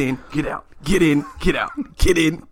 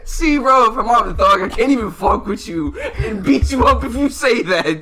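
normal talking voice

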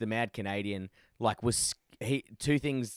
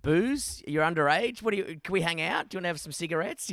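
fast, high eager backpedal, a bit hammed-up; nervous fawning; 6 semitones higher, faster than usual. Telling mate about scary teens, mock eager-to-please. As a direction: grovel to the huge teenagers, rushing.

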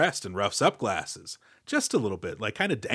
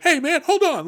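high-pitched pleading yelp; hammed-up action-movie alarm; mock panic; 4 dB louder than usual. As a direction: beg like you're dangling off a roof. Action-movie victim dangled off roof.

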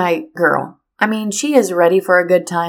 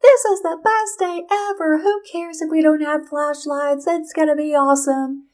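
put-on much higher, bubbly little-girl voice, full of glee; giddy young daughter; 9 semitones higher than usual. Mom imitating her daughter skipping through leaves, higher and giddy. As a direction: play the daughter frolicking without a care. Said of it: "Just like".

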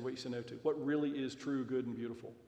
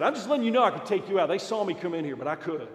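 menacing boast; 5 semitones higher, 11 dB louder than usual. Retold or imitated. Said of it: "And he said".

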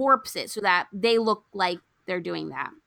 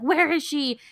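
higher-pitched mock panic; retold freak-out; 7 semitones higher than usual. Family searching for the runaway, mock freak-out. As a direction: imitate everyone frantically searching for her. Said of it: "Everyone is freaking out".

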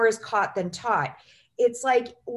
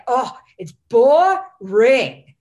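slow, much louder, drawn-out dismissive mom voice; mom's bored scoff; 10 dB louder, much slower than usual. Retold or imitated. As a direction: imitate mom scoffing at Florida, slowly and loud. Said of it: "she'd be like".

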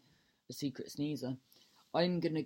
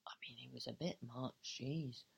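whisper; 5 semitones lower, 10 dB quieter than usual. Real, not acted. Embarrassed aside about a fan.

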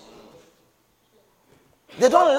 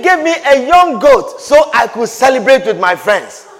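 resentful son; 5 semitones higher, 7 dB louder than usual. Acted. Elder son's grievance quoted.

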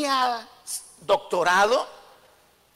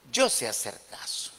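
"yo sé hacer caso" said much lower and softer; deep, soft punchline; 14 semitones lower, 9 dB quieter than usual. Shown as a demonstration. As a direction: drop "yo sé hacer caso" low and quiet like a meek confession. ("yo sé hacer caso" mucho más grave y suave)

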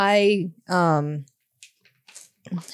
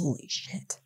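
awed whisper; 12 dB quieter, slower than usual. Acted. Awed whisper at withering trees.

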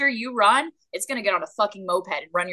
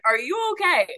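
higher, lightly mocked worried question; mock concerned friend; 9 semitones higher than usual. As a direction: play the worried friends, then laugh. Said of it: "They're probably like".

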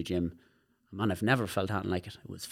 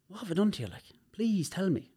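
retold pleading; 9 semitones higher than usual. Speaker begs cousin to explain, pleading. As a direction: replay begging someone for an answer, pitched well up.

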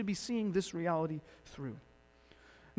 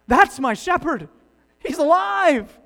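loud, rising higher, overjoyed announcement; joyful exclaim; 9 semitones higher, 12 dB louder than usual. Acted. Mary realizing her shepherd lives, joyful exclaim. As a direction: blurt it out in wonder.

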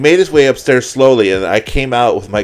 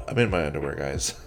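lower, much softer tired plea; weary soft plea; 6 semitones lower, 11 dB quieter than usual. Retold or imitated. Host in underwear pleads with friends, soft and weary. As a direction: sigh it out, defeated.

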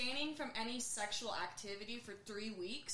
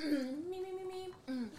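theatrical vocal warm-up; 8 semitones higher, 4 dB louder than usual. Shown as a demonstration. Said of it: "nice projecting theatrical voice".

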